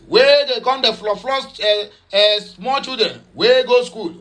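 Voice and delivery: ordinary talk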